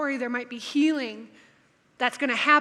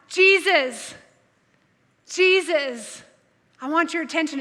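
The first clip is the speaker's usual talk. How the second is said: raised call, louder, pausing between names; shouting for attention; 4 semitones higher, 5 dB louder than usual. A narrator's reading. Blind man shouting to Jesus for attention, raised voice. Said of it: "he began to shout".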